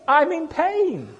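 high, drawn-out mock complaint; imitated pained wail. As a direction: wail it slowly, as if wanting an ear. Voices the woman's complaint of pain.

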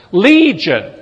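demon's loud answer. A narrator's reading.

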